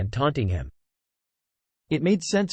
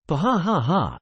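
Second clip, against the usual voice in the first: mocking laughter; 3 semitones higher than usual. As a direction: laugh it off in scorn. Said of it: "burst into laughter".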